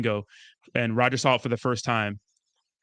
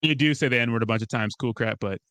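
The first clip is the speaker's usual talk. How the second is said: ordinary delivery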